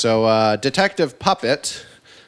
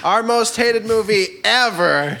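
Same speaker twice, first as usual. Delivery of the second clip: German accent; 9 semitones higher, slower than usual. Shown as a demonstration. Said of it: "I wanna do the German accent".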